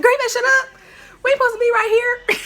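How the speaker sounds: very high, loud and fast; panicked hushing yell. Retold or imitated. Girl panicking at her cousin for shouting, high loud and fast. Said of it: "I'm like".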